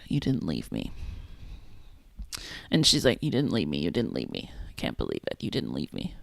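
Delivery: soft, low, panting mock-whisper; breathless whisper-quiet. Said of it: "they're panting and she's like".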